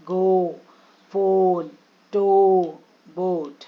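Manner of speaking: गो, फोन, टो, बोट with a drawn-out oh; long O sounds. Shown as a demonstration. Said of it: "ओ sounds".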